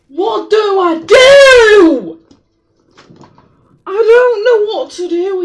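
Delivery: a half-sobbing cry of helplessness; wailing in real frustration at being lost; real despairing wail. Real, not acted.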